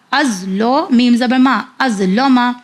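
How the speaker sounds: ordinary delivery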